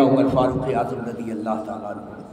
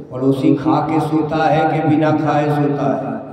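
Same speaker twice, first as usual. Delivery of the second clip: ordinary delivery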